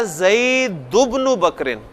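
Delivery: slow "ज़ैदुब्नु बकरिन", zaidu-bnu with the tanween dropped; "ज़ैदुब्नु" joined straight from du into bnu, no n sound between; shortened linked reading. Shown as a demonstration. Teacher showing the easier reading, slow and linked without tanween. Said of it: "तो ये अब पढ़ा जाएगा".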